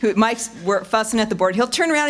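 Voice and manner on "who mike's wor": ordinary talk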